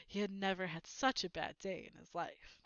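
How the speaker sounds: plain reading voice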